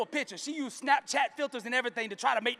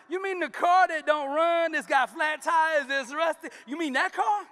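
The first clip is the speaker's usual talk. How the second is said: incredulous daughter; 7 semitones higher, 6 dB louder than usual. Acted. Daughter scoffing at old car.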